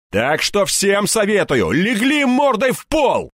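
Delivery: high shout, slow and drawn out; barked order. A narrator's reading. Hero ordering thugs to the floor, barking. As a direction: build to a roaring command. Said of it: "рявкнул я".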